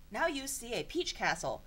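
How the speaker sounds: usual speaking voice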